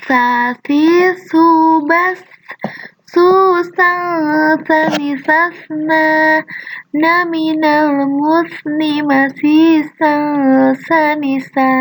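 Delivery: slow chanted syllables with the tongue tip between the teeth; teacher modelling Arabic letter sounds slowly; tongue-tip bite drill. Shown as a demonstration. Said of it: "Ujung lidahnya itu digigit sedikit ya".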